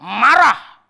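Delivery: MARAH punched out very loud and drawn out; "marah" exploded loud and stretched; hammered emphasis. Real, not acted. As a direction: shout the word "marah" with a long, high swell. Names the angry response forcefully.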